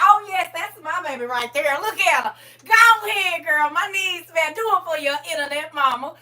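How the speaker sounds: loud hype-mom encouragement; proud cheering. Retold or imitated. Internet mama cheering her girl on the runway. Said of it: "yelling at it".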